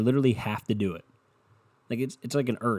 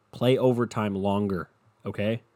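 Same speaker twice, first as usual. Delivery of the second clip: ordinary delivery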